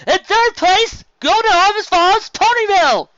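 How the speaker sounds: high, loud, slow proclamation; awards announcer. Acted. Announcer calling the first-place winner, loud and slow. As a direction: boom it to the crowd.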